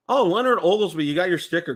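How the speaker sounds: normal talking voice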